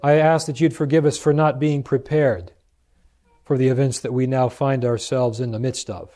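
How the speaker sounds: usual speaking voice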